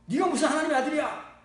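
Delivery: loud, high-pitched indignant challenge; acted outburst of scandalized accusers; outraged crowd. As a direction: imitate an angry mob raising an uproar. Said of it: "막 난리를 치는 거야".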